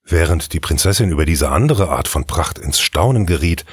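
ordinary delivery

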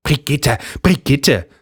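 high, sing-song mimicry of him; mocking her husband. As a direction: ape his weary tone mockingly. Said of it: "äffte seine Frau ihn nach".

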